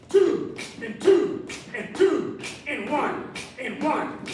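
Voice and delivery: high, loud pumped-up rep count; excited countdown. Acted. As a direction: whoop between counts to fire them up. Counting down reps, whooping.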